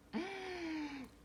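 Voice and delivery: sharp high gasp of outrage; shocked gasp. Real, not acted. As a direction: gasp in horror at the nerve. Stranger sits on mom's car.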